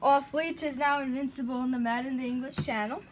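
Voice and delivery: put-on posh-ish British accent, slowed down. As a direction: play the Brit with a British accent. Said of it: "why did I switch my accent to British".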